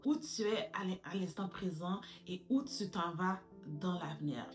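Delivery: ordinary delivery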